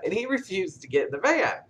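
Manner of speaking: usual speaking voice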